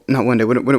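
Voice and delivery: usual speaking voice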